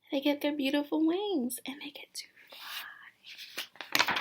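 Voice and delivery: hushed storyteller whisper. Acted. Teacher whispering the magical moment to children. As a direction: breathe it out softly, slowing at the end. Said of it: "whispered storytelling".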